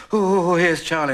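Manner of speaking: high, drawn-out, mock-eager coo; mock delight. Father mimicking last month's welcome of Charlie, mock delight. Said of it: "for the last month it's been".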